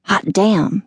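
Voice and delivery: plain reading voice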